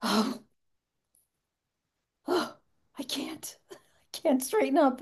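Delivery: weak, halting groans of pain, re-enacted; imitating back pain. Showing how getting out of the chair sounded, pained and straining. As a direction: act out struggling up with a sore back, voice nearly gone. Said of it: "That's how I sounded".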